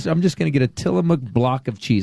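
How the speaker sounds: ordinary talk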